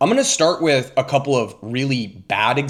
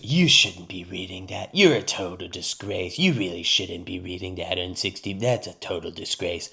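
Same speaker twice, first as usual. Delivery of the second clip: lower, darker mock Trump scolding; a lower voice imitating Trump scolding a reporter; 6 semitones lower, 6 dB quieter than usual. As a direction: growl it out like the president scolding. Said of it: "he goes".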